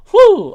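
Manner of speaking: loud high relieved exhale; relieved whew. Real, not acted. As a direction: blow out hard in relief. Recovering after choking fit.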